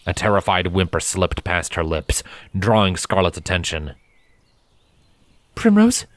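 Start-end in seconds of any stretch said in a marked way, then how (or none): 5.5-6.1 concerned. A narrator's reading. Scarlet asks the frightened Primrose, concerned character voice. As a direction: check on the filly. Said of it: "she asked in concern".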